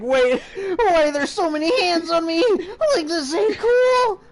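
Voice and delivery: high, loud laughing panic; panicked. Acted. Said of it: "You're like".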